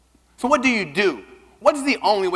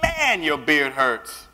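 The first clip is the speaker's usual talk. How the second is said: slow, drawn-out complaint; much slower than usual. Retold or imitated. After the first kiss, slow dismayed complaint. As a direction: stretch the words out in dismay. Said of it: "And I go".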